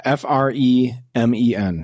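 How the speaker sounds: slowly spelling letter by letter; spelled out. Shown as a demonstration. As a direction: spell it out slowly for someone who misheard. Spells the corrected word.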